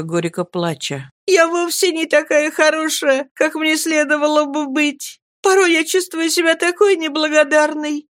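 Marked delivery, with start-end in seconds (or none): 1.3-8.1 put-on tearful young girl. A narrator's reading. Girl tearfully reproaching herself.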